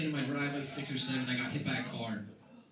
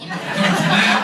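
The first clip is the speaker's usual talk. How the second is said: louder, higher cry; loud strained outburst; 6 semitones higher, 14 dB louder than usual. Acted. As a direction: wail it, overwhelmed. Comic outburst.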